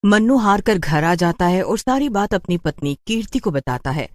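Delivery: plain reading voice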